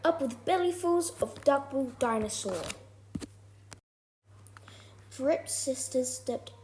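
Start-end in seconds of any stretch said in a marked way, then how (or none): none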